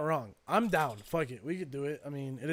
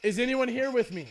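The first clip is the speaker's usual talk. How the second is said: calling out to spirits. Acted. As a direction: call out into an empty room. Asking the spirit box questions.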